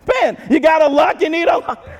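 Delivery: strut it like the one with the keys; cocky Peter. Retold or imitated. Peter showing off to the disciples, cocky and high. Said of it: "He's like".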